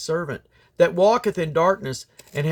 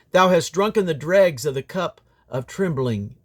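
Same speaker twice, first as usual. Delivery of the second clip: ordinary talk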